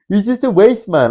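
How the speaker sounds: high, loud roadman impression; mock roadman. Streamer parroting his critic, loud high mock roadman. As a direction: throw the insult back in a high mocking voice. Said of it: "thanks, Mister Roadman".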